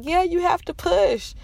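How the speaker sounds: a casual nurse telling her to push; mocking nurse. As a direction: toss off the order lightly.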